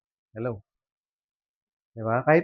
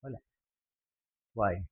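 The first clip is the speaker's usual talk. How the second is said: ordinary talk, nothing marked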